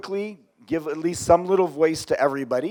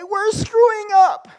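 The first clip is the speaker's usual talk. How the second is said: high, loud, drawn-out whooping yell; yell and scream; 7 dB louder than usual. Shown as a demonstration. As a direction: yell it out like a celebrating team. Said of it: "yell and scream and say".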